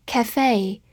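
cafe said slowly, both syllables drawn out; French loanword. Shown as a demonstration. Teacher slowly pronouncing the French loanword cafe. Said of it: "法语的单词".

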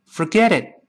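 quick linked "Forget it" as for-geh-dit; held final t. Shown as a demonstration. Teacher leads students, "Forget it" linked with final t held. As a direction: stop "it" short with no burst on the t. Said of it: "读快了之后会憋住气".

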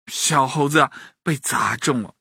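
ordinary delivery

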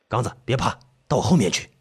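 low, soft steadying voice; protective big brother. Acted. Fifth brother telling Gangzi to get behind him, steady.

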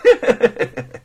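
loud, high chuckle; gleeful cackle; mocking laugh. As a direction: laugh at the man you just fooled. Prisoner laughs after his joke.

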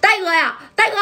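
high shout of his brother's name; calling out. Acted. Shunzi shouts to warn Dai-ge across the street, high call. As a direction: yell across to catch Dai-ge's ear. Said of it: "这顺子就喊了一声".

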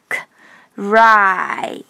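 "k" as pure air, then long "ry"; slow sound-by-sound demo of "k", "ry"; voiceless k. Shown as a demonstration. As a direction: split "cry" into "k" and a stretched "ry". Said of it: "清辅音k".

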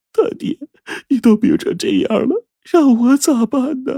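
very high, tearful wail; sobbing. A narrator's reading. Wife despairs over her sick husband, crying. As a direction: wail helplessly through tears, drawing out the end. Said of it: "呜呜地哭着".

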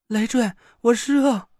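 put-on high, slow pained girl voice.

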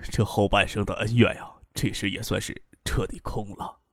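low, fading to very soft; wistful sighing reflection. A narrator's reading. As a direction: let the words sink away in quiet resignation. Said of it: "颇为感慨地轻轻一叹， 又说道".

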